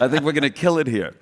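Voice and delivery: usual speaking voice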